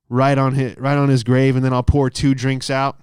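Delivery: ordinary delivery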